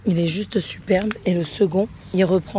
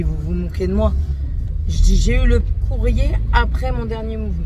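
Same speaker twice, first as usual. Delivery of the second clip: louder, heated complaint; angry retelling; 2 semitones higher, 5 dB louder than usual. Retold or imitated. Retelling her angry call to the bank, heated and loud. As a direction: vent it like the call still stings. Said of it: "Je me suis énervée".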